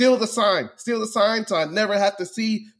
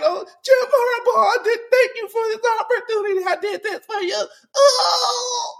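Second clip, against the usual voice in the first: very high, hammed-up fake sobbing through laughter; mock crying coach. Mocking the interim coach thanking Harbaugh, very high fake sobbing. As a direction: wail it like an overcome coach, clearly mocking. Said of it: "the interim coach out here crying".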